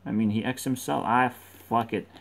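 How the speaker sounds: normal talking voice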